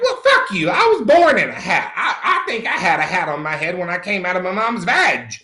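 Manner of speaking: laughing defiance. Retold or imitated. Refusing to give up hats, a laughing retort. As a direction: laugh your way through the comeback. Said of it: "I'm like".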